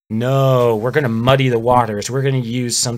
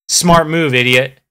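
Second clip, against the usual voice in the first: sarcastic; 3 semitones higher, 6 dB louder than usual. Real, not acted. Sarcastic jab at gun-ban logic.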